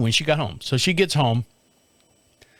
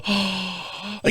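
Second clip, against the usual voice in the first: eager gasp; greedy gasp; 3 semitones higher, 5 dB quieter than usual. Retold or imitated. Husband reaching for the food bag, eager gasp. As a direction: gasp like a kid at candy.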